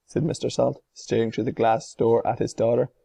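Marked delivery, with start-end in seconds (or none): none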